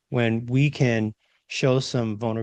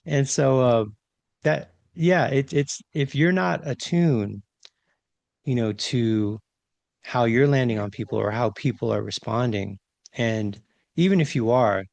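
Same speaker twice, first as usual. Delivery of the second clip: ordinary talk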